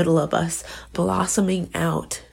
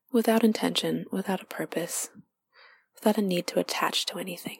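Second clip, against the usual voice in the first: guided meditation; 2 semitones higher than usual. Acted. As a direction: lead them inward gently, half whispered.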